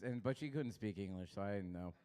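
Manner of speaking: ordinary delivery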